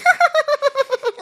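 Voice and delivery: high mock giggle; loud-kid giggle, very high, put on; imitated laughing. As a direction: mimic a kid's nonstop high laughing. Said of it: "he would just be laughing".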